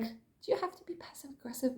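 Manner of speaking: soft, near-whispered retort; hushed aside, as if only thinking it; unspoken thought. Retold or imitated. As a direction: voice an irked thought under your breath. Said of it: "I was thinking it".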